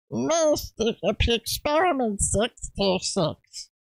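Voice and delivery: slow, high throaty alien rasp; Stitch impression. Shown as a demonstration. Streamer demoing an easy Stitch voice for chat. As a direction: squeeze it from the back of the throat. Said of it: "You just go".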